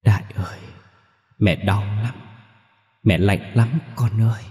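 a lightly voiced tearful ghost, rising a little. A narrator's reading. Ghost mother at her son's bedside, mildly tearful. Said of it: "khóc rưng rưng".

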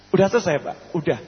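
ordinary talk

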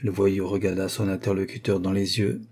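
ordinary delivery, nothing marked

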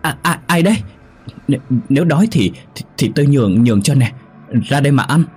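high-pitched, halting stutter, tripping over words; frightened stammering. A narrator's reading. Terrified traveller offers bread to an unseen voice, stammering. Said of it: "rồi lắp bắp".

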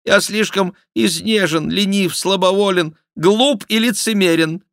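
high, loud repentant whine; put-on contrite confession; penitent mock king. A narrator's reading. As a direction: repent loudly on the king's behalf. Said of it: "каялся Шико от имени короля".

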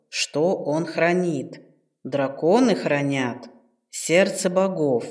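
low, slow patient ghost; old ghost voice. Acted. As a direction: explain slowly like an old spirit. Ghost ancestor explains. (старый призрак, неспешно)

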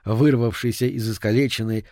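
ordinary delivery, nothing marked

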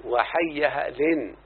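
slow, drawn-out "وحيها لن" ending in n; tanween ending. Shown as a demonstration. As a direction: stretch "وحيها لن" to show its final n. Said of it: "فطالما قبل التنوين".

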